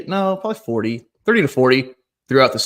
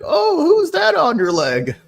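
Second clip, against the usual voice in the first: put-on high, drawn-out old lady voice; slower than usual. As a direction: coo a nosy question, high and slow. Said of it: "I had an old lady".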